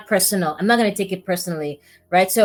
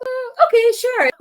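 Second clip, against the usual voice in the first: much higher, grudging okay sure; reluctant agreement; 6 dB louder than usual. Retold or imitated. Agreeing to the talk she'd rather avoid, grudging. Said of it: "And I was like".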